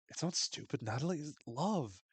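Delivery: mock-earnest speech swelling to a rapturous peak; earnest Coach. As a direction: preach love like a tripping coach. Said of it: "Coach is like".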